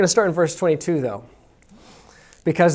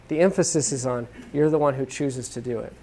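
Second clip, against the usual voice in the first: ordinary delivery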